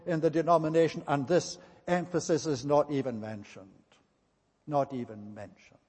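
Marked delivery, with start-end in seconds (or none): 4.7-5.8 much softer and lower, letting it sink in; hushed repeat. Real, not acted. Preacher quietly repeats the point to the congregation, hushed. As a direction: drop the voice as if in disbelief.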